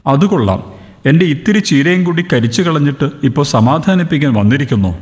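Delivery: ordinary delivery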